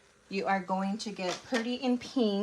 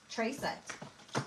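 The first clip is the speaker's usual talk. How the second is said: whispered aside; 3 semitones higher, 10 dB quieter than usual. Real, not acted. Trails off to herself.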